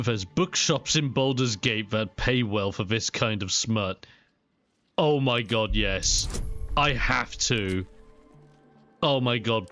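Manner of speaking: ordinary talk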